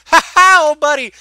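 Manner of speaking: loud, high ha-ha greeting; mock hearty laugh. Imitating the hug after pretending to hit him, mock hearty laugh. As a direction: burst into an exaggerated old-pal laugh.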